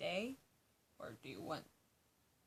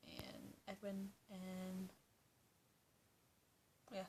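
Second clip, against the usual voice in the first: whisper; 14 dB quieter, slower than usual. Real, not acted. Trailing whispered addition.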